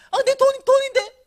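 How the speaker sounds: loud, high startled protest; dismayed surprise. Retold or imitated. Dreamer shocked that she didn't ask for money, high dismayed outburst. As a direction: gasp at your own mistake. Dismayed at her own words.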